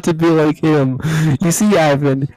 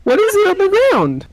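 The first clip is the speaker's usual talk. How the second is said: put-on girlish voice. S1 voicing a girl character.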